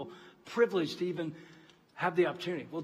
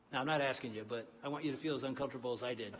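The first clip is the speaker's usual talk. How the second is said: ordinary delivery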